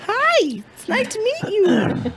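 tiny cartoon voice; high squeaky voice. Wizard of Oz voice.

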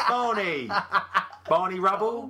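bad Cockney. Podcaster mocking a film actor's rhyming slang, hammed-up Cockney. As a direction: call it out in exaggerated bad Cockney. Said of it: "Today we're gonna do Cockney rhyming slang".

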